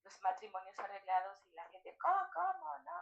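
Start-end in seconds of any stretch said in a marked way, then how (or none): 2.0-2.9 high, loud incredulous outburst; mock shocked disbelief. People hearing of arranged marriages, shocked. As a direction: act out scandalised onlookers.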